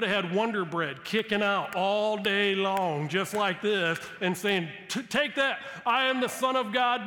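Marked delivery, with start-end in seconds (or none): 4.9-7.1 higher and louder, gloating in his face; triumphant taunt. Pretend. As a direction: slam it down like a winning dunk. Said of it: "rub it in the devil's face".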